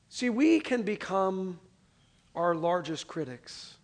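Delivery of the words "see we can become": ordinary delivery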